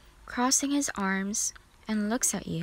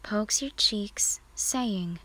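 ordinary delivery

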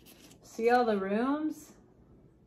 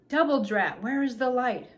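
louder, punchy tiny-character grumble; lightly voiced cross little king; mild exasperation; 3 semitones higher, 5 dB louder than usual. A narrator's reading. Tiny king hunting for the light, mildly exasperated.

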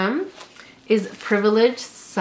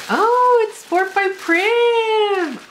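high, louder, stretched-out squeal; thrilled squeal; 12 semitones higher, 3 dB louder, much slower than usual. Real, not acted. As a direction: squeal with sudden delight. Thrilled by the next item.

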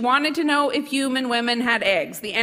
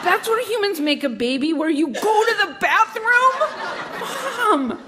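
put-on higher, appalled child's outburst; disgusted child; 8 semitones higher than usual. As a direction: protest in rising, grossed-out disbelief. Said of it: "twisted up with a look of disgust".

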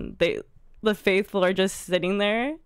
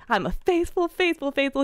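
imitating proud joy, pitched high; happy, proud; 7 semitones higher than usual. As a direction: announce it like a retold moment of triumph. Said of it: "so happy, so proud".

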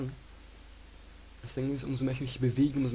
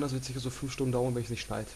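ordinary delivery

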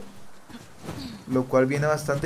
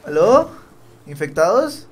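loud calling into the silence; 3 semitones higher, 7 dB louder, slower than usual. Real, not acted. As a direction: call out to anyone there. Calling out in empty place. (llamando en voz alta)

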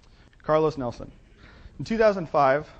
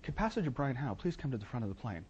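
softer, lightly imitated PA voice; loudspeaker announcement; 8 dB quieter than usual. Airline pages passenger over loudspeaker, softly imitated announcement. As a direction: imitate a cabin announcement, held back. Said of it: "comes over the, the loudspeaker".

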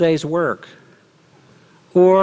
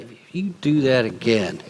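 warning delivered with a hard glare on do; threat with the eye; 6 semitones lower, 3 dB quieter than usual. Shown as a demonstration. Preacher redoing the threat with the eye look. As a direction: deliver the threat again, landing on do. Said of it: "you gotta do the eye thing".

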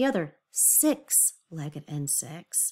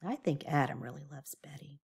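teasing aside; 5 semitones lower, 10 dB quieter than usual. Real, not acted.

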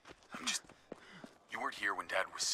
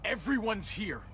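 rising, high-pitched fury; furious. Acted. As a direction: build from indignant to a furious yell. Furious quarrel between brothers.

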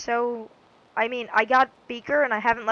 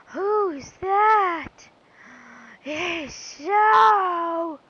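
shocked; 7 semitones higher, 6 dB louder, much slower than usual. Real, not acted. Shocked reaction to ugly Pokémon.

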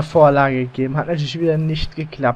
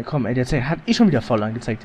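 usual speaking voice

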